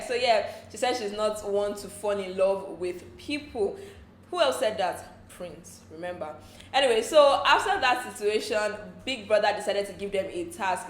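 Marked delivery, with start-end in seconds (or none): none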